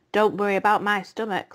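usual speaking voice

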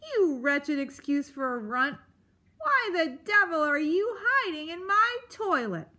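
high, scolding porcupine rant; indignant cry. A narrator's reading. Porcupine finding a mouse in his toilet, indignant. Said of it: "he cried".

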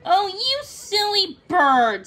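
high, loud, drawn-out reproach; fond scolding. A narrator's reading. Thumbelina answers the stubborn bird, scolding.

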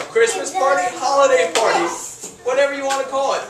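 ordinary talk, nothing marked

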